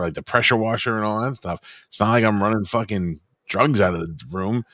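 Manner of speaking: hammed-up panic talking his way out; defensive backpedal. His own retold excuse to his wife, mock defensive. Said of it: "I go".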